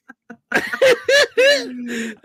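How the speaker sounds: loud high cackle; real laughter. Real, not acted. Host still tickled by friend's joke, loud high laughter.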